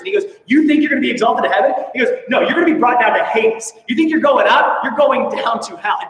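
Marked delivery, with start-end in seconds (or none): 0.5-1.7 fast, louder, higher mocking retort; sarcastic. Jesus mocking Capernaum's boast, loud fast sarcastic jab. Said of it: "Jesus is sarcastic". 2.3-6.0 louder and higher, a cutting sarcastic reply; sarcastic put-down. Acted. Jesus answering Capernaum's boast, loud sarcastic put-down. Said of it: "Jesus is sarcastic".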